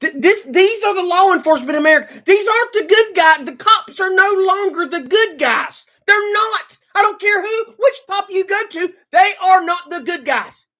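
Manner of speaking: loud, high, indignant; angry rant. Real, not acted. Ranting to viewers about police, loud and furious. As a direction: shout it out, fed up with the cops.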